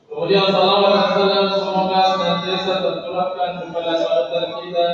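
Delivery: usual speaking voice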